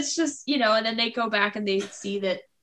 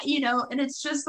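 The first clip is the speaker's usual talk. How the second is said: ordinary delivery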